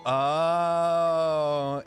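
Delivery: ordinary talk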